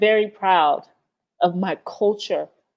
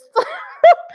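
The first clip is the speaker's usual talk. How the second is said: high burst of laughter through tears; teary high laugh; 6 dB louder than usual. Real, not acted. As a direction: let out a high tearful laugh. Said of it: "My freaking tears".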